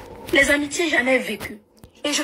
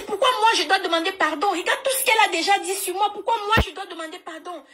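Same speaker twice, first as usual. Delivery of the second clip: indignant; 8 semitones higher than usual. Retold or imitated. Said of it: "Je dis".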